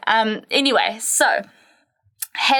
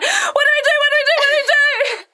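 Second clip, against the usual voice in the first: panicking; faster than usual. Retold or imitated. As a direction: replay the frantic panic. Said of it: "a panic mode".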